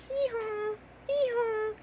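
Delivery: high hee-haw bray; donkey bray. Shown as a demonstration. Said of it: "What does a donkey say".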